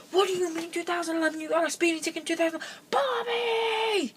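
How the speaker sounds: high-pitched frantic dad impression; imitating dad screaming. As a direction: play a dad losing it and yelling for his wife. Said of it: "he's screaming for my mom".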